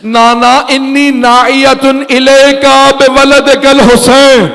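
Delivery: slow, high, keening call; wailing cry. Acted. Drawn-out mournful call. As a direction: Zainab cries aloud to her grandfather at his tomb, slow and keening. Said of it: "بلند آواز سے رو کے کہا".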